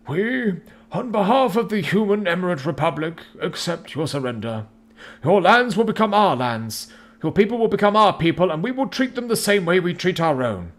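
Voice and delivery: a victorious general laying down terms; stern general. A narrator's reading. General accepts surrender.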